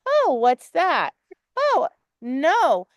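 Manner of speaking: slow, mock-worried oh, what's that; worried owner. Coach mimicking the student's worried reaction, slow mock worry. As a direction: imitate the student's anxious reaction, drawn out. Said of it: "the bigger lesson is you went".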